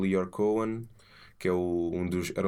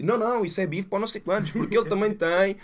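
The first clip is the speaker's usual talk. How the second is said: excited accusing; 9 semitones higher, 4 dB louder than usual. Retold or imitated. Imitating conspiracy listener.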